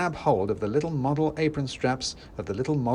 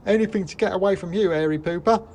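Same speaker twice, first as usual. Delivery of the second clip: put-on much higher, louder bratty child voice; cheeky kids; 10 semitones higher, 6 dB louder than usual. A narrator's reading. Escaping kids jeering at the narrator, high and loud. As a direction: jeer it like a rude little kid. Kids yell a rude reply.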